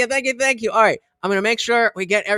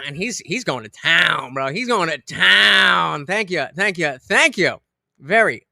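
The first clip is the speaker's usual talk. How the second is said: ordinary delivery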